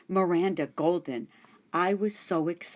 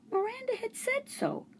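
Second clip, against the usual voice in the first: high hamster voice; 5 dB quieter than usual. Acted. Hamster reassures itself.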